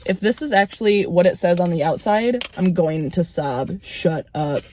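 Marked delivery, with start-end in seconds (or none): none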